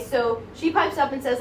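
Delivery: ordinary delivery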